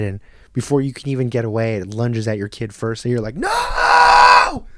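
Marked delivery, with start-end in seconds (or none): 3.4-4.7 long, high screamed NO; hammed-up cry of horror; horrified scream. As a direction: scream it out, drawn out and full volume. Acted parent as kid is attacked.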